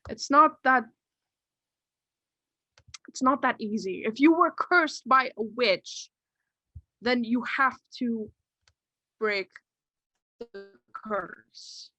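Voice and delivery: earnest doctor in character. Acted. Doctor warning the cursed boy, emphatic. Game master playing the doctor.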